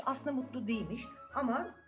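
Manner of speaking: ordinary delivery, nothing marked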